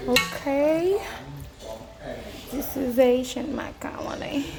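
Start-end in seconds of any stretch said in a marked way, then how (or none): 2.5-4.5 hushed whisper to camera. Real, not acted. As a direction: whisper it like a secret. Whispered food vlog intro.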